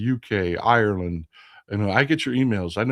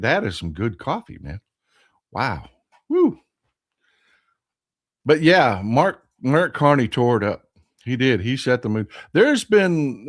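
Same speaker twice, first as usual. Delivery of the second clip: ordinary talk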